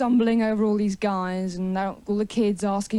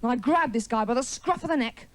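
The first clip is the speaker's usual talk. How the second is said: usual speaking voice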